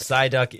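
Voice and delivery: emphatic coaching demo, weight on Sigh; stress on SIGH. Shown as a demonstration. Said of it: "Sigh is the operative syllable".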